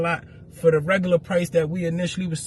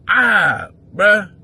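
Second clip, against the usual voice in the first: exasperated cry; 4 semitones higher, 9 dB louder than usual. Real, not acted. Outraged at the promoter's demand.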